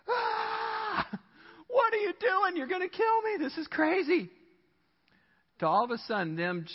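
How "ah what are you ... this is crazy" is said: very high screamed panic; mock terrified passenger. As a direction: shriek in hammed-up fear at the driver. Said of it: "person in the passenger seat going".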